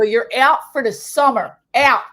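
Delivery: loud imitated lifeguard order; loud bossy sister. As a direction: lay down the law like a big sister. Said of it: "really loud like that".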